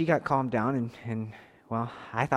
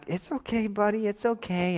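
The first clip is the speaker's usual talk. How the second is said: gentle it's okay, buddy; soothing a crying son; 8 semitones higher than usual. Retold or imitated. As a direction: comfort a scared little boy.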